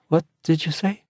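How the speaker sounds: high, startled question; dazed surprise. A narrator's reading. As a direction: snap out of a daze.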